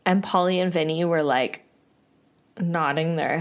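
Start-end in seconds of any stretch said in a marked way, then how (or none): none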